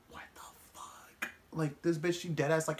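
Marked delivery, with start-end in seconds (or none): 0.1-1.3 whispered, much softer disbelief; shocked whisper. Retold or imitated. Seeing the voodoo dolls, a shocked whisper. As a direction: breathe it out in horror.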